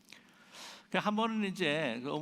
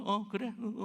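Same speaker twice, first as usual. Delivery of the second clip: higher-pitched, half-listening acknowledgement; perfunctory; 7 semitones higher than usual. Retold or imitated. Absorbed eater answering a talking friend, mock perfunctory. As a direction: imitate someone absently going along while busy eating. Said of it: "근성으로".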